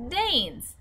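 high, falling cry of triumph; triumphant cry. A narrator's reading. Giant answering Sophie's Labrador question, triumphant. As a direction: announce it like a winning answer. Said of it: "cried triumphantly".

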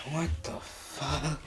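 slow, soft, creeped-out murmur; spooked murmur. Acted. Man alone in his basement hearing noises, slow spooked murmur. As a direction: barely breathe it out, unnerved.

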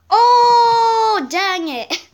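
long, high, loud "oh"; frustrated outcry. Real, not acted. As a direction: wail it out at the setback. Sudden setback in game.

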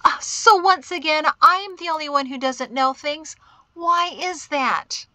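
high, loud exasperated complaint. A narrator's reading. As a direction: complain theatrically, drawing out the final question. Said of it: "Mari threw her hands in the air".

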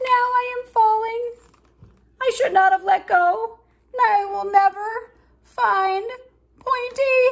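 high, loud, panicked cry; falling in fright. Acted. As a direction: yelp it while tumbling through the air. Tiny falls from a height.

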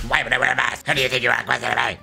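mocking tough guy.

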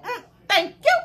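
high, loud, pointed thank-you; mock curt thanks. Customer snatching her card back, loud mock thanks.

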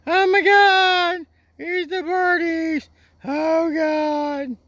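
very high drawn-out falsetto squeal; mock freaking out. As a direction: panic theatrically in a squeaky voice. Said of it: "They're, like, freaking out".

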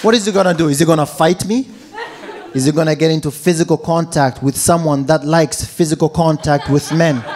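ordinary talk

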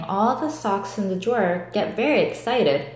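plain reading voice

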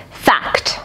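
hushed announcement; whispered fact. A narrator's reading. Narrator announces a fact to the children, whispered. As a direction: breathe out the word fact.

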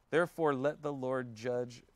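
ordinary talk

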